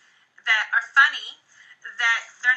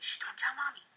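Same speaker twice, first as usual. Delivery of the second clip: secretive hush; 10 dB quieter than usual. Retold or imitated. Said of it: "I'll be secretive about it".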